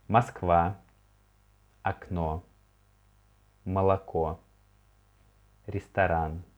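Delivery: word list read quickly for repeating; brisk teacher read-through. Shown as a demonstration. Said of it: "read it once again ... quicker".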